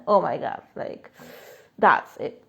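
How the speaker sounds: usual speaking voice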